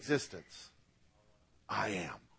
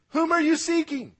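much higher, louder question; raised voice; 13 semitones higher, 5 dB louder than usual. Acted. Preacher voicing Jesus asking the soldiers again, high and loud. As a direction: lift the question high and strong.

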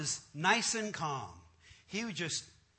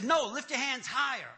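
higher, louder mock-pastor order; insistent command; 5 semitones higher, 5 dB louder than usual. Pastor demanding more from the man, loudly insistent. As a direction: push him as the bossy preacher.

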